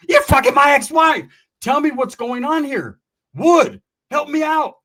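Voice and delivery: high, loud accusing yell; furious shouted accusation, pleading for help at the end; angry shouting. Retold or imitated. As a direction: yell it at the man who betrayed you. Confronting his ex-wife's partner.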